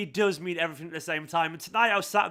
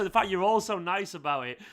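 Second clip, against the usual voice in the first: usual speaking voice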